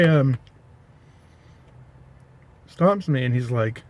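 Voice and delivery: ordinary delivery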